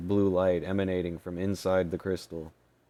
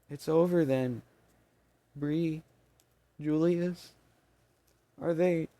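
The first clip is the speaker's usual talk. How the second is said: grieving squeaky fairy; 10 semitones higher than usual. Acted. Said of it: "Fitzel couldn't finish".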